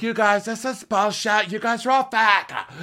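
high, loud exasperated outburst; mock outburst. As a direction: blow up at the group. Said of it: "Lisa's like".

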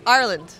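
Ireland corrected to sound like "our land"; local pronunciation. Shown as a demonstration. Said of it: "The pronunciation?".

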